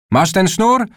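high-pitched call with rising question. A narrator's reading. Mother calling from below the tree, calling up. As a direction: call up to someone out of reach. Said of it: "wołała z dołu mama".

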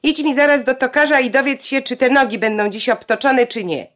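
loud, high commanding shout; father's shout. A narrator's reading. Father shouting an order at Janka from the doorway, loud and high. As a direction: bark the errand at her. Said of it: "i krzyknął na Jankę".

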